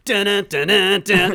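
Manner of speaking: building mock score; mock dramatic stings. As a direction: hammer out dramatic music stabs. Imitating dramatic music beat.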